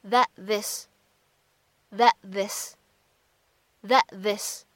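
"that this" with the t of "that" held unreleased; "that this" without releasing the t; incomplete plosion. Shown as a demonstration. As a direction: swallow the final t of "that" into "this". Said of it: "我们呢，可以读成是".

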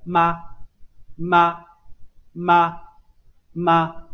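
妈 said as ma, lips pressed shut then opened into a; crisp, emphatic 妈 with closed lips popping open to a; model syllable ma. Shown as a demonstration. Teacher modelling 妈 for students, crisp and emphatic. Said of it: "妈，迅速发元音".

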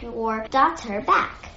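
usual speaking voice

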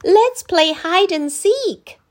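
put-on high, slow child voice; excited children. A narrator's reading. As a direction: invite him to play. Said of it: "said the children".

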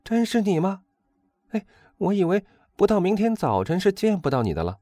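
very high, loud, astonished greeting; excited shout. A narrator's reading. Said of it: "他跳起来喊道".

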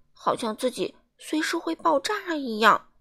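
ordinary delivery

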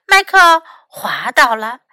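usual speaking voice

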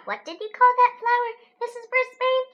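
put-on high, loud eager kid; excited child voice. A narrator's reading. Child asks teacher excitedly.

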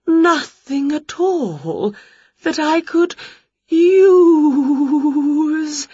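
high quavering slow spook, tearful; a precious ghost seeking inspiration; fussy ghost. Acted. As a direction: sigh and swoon over each word. Ghost searching.

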